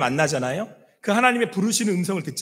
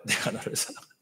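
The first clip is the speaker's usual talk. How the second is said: breathy whisper, softer; whispered God's voice; 3 semitones higher, 9 dB quieter, faster than usual. Acted. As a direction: whisper the words of love. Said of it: "똑같이 또 그러시는 거예요".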